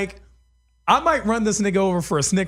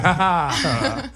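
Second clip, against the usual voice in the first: slow low mocking ha ha ha; mocking laugh; 4 semitones lower, much slower than usual. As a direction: laugh at him like you're about to shoot. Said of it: "That's the mocking laugh".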